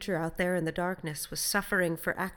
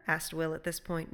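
usual speaking voice

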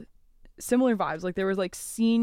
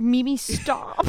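mock jealous plea to grandma; 7 semitones higher, 6 dB louder, much slower than usual. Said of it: "You were like".

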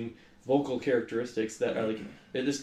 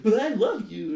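higher mocking whiny quote; higher mock pleading; mocking the kid; 7 semitones higher, 3 dB louder than usual. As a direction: lightly send up the kid's line. Quoting the kid's line mockingly.

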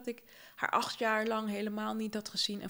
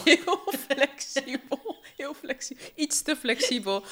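laughing through the words, higher and louder; 6 semitones higher, 7 dB louder, slower than usual. Real, not acted. Guest laughs at the host's joke, laughing. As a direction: let the laugh carry the phrase slowly. Laughs at being called flexible.

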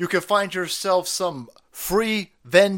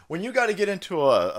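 usual speaking voice

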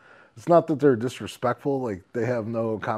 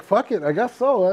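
higher, louder resigned shrug; 11 semitones higher, 7 dB louder than usual. Retold or imitated. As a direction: shrug it off, giving in. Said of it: "I'm like".

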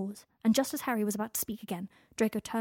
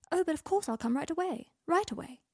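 mocking sarcasm; 8 semitones higher than usual. Mocking quote of letter.

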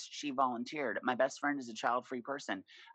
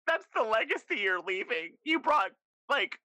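mock outrage; 7 semitones higher, 6 dB louder than usual. Real, not acted. Mock cursing Hobby Lobby gratefully.

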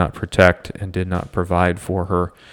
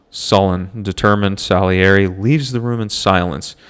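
ordinary delivery

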